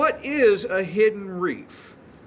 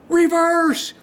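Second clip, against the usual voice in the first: sudden yelled command, high and loud; urgent shout; 10 semitones higher, 5 dB louder than usual. Retold or imitated. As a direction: bark it like a warning of danger. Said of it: "and he goes".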